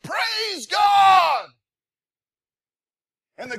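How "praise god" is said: loud joyful shout, high; shouting praise. Pretend. Driver alone in car screaming praise, loud joyful shout. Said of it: "screaming at the top of your lungs".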